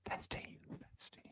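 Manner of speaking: faint, airy ghost voice; ghostly voice. Pretend. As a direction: moan it like a ghost. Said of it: "Ghostly voice".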